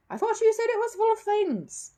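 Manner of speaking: high, loud dismayed magician; stunned outburst; exclaiming. Acted. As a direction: caught out in front of the crowd. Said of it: "he exclaimed".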